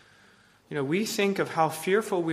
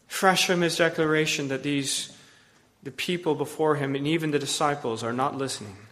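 ordinary talk

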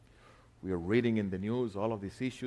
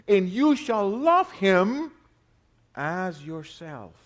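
love stretched slow, higher and louder; emphatic scripture reading; 6 semitones higher, 6 dB louder, slower than usual. Shown as a demonstration. Preacher stressing love to the congregation. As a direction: lean hard on love, drawn out.

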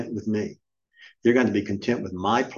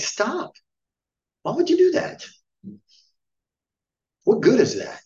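exasperated disbelief; 13 semitones higher than usual. Real, not acted. Preacher scolding whiners, high exasperated disbelief. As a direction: pitch shoots up high in fed-up disbelief.

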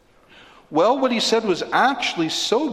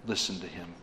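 hushed "Listen to him"; 8 semitones lower, 12 dB quieter, faster than usual. Acted. Very quiet quoting.

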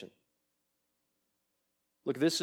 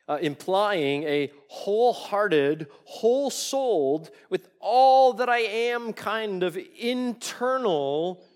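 impassioned preaching; 5 semitones higher, 9 dB louder than usual. Real, not acted. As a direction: swell with fervour over every word. Preacher emphasizes wholehearted obedience.

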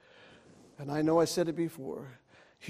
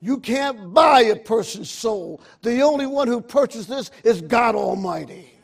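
louder, higher, rising sermon climax; full-force preaching; 4 semitones higher, 9 dB louder than usual. Acted. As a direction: preach it out with building fire.

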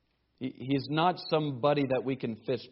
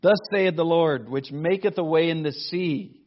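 louder, solemn reading of scripture; 6 dB louder than usual. Acted. Preacher reads scripture aloud.

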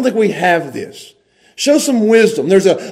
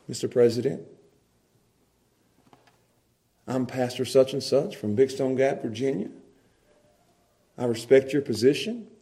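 much softer, lower respectful address; respectful and gentle; 6 semitones lower, 10 dB quieter than usual. Pretend. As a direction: soothe and honour him. The wise respectful approach.

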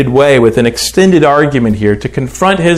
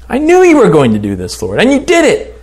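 indignant complaint; 7 semitones higher than usual. Acted. Jonah complaining to God.

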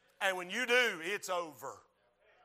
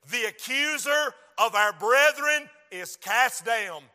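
slow, loud, raised declamation; full-force scripture preaching; 5 semitones higher, 11 dB louder, slower than usual. Real, not acted. Preacher reading the accuser verse to church, full-force preaching. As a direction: hammer out the verse word by word.

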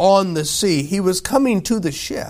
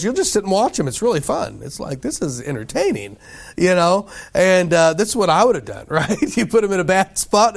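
ordinary delivery

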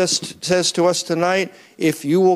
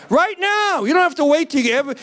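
excited; 9 semitones higher than usual. Acted. Preacher urging congregation immediacy.

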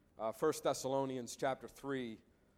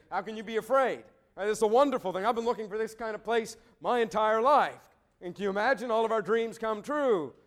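excited crew; 6 semitones higher, 5 dB louder than usual. Retold or imitated. Crew's surprised eager reply.

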